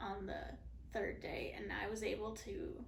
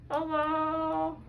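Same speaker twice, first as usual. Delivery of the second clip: groggy; 6 semitones higher, 9 dB louder than usual. Real, not acted. Post-surgery groggy clip.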